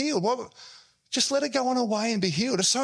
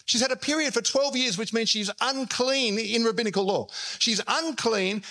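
usual speaking voice

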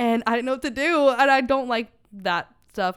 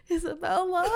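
wailing call; 7 semitones higher than usual. Retold or imitated. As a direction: call the name in exaggerated distress. Repeats the call for help.

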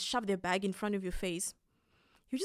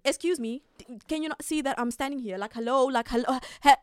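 louder, higher indignant call-out; queue confrontation; 4 semitones higher, 7 dB louder than usual. Retold or imitated. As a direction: snap at the queue-jumper. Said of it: "what do you think is going to happen?".

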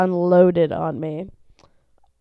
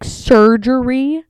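slow, louder rising disbelief; disbelieving; 4 semitones higher, 6 dB louder, much slower than usual. Retold or imitated. Owner to vet, slow shocked question. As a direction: stretch it out in shock. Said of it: "I was like".

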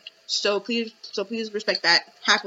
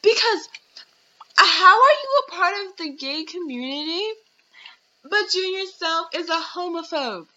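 high, loud indignation; high indignant rant; 6 semitones higher, 3 dB louder than usual. Real, not acted. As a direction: throw the question up high.

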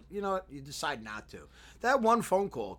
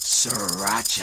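slow, loud announcer call of the name; hype name call; 5 semitones lower, 9 dB louder, much slower than usual. Pretend. Co-host announcing his own name on the podcast, drawn-out hype call. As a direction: stretch the name out like a ring announcer.

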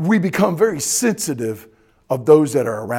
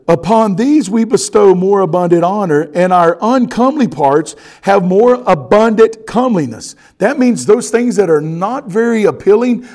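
loud, raised preacher's delivery; full-force preaching; 5 semitones higher, 10 dB louder than usual. Acted. Pastor expounding scripture to his congregation, preaching loudly. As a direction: project it hard to the congregation.

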